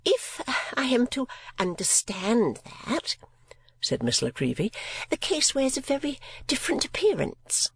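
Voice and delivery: high, affected spinster voice; mincing lady voice. A narrator's reading. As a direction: play a fussy miniature painter. Miss La Creevy speaks.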